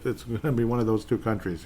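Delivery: usual speaking voice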